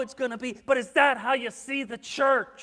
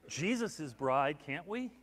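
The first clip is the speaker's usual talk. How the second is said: ordinary talk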